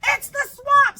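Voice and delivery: high, loud mock panic; hammed-up scared shout; scared cry. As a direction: shriek at the monster teacher. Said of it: "cried the kids".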